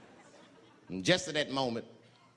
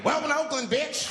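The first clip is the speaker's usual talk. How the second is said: loud, high mocking welcome; mock welcome shout; 9 semitones higher, 5 dB louder than usual. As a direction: yell it like a tough local greeting. Greeting voice on arrival.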